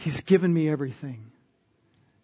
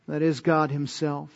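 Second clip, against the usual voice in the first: normal talking voice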